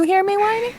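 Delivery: soft, high rising question; whining. Shown as a demonstration. Asking chat if they hear it, high whine. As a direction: lift it high and small, climbing to the end.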